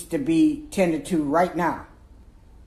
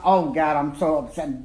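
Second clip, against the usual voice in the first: ordinary talk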